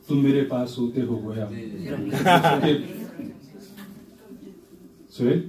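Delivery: playful measured poetic recital; humorous nazm recitation. Acted. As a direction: recite with a wry smile. Recites tazmeen.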